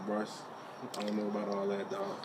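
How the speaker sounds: low, quiet thug menace. Acted. Caller demanding his money from Bryce, low thug voice. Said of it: "I got to pull out the thug voice".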